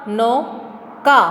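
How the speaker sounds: नौका drawn out slowly; teacher drawling नौका slowly; slow stretched reading. Shown as a demonstration. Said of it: "इसी तरह दूसरा".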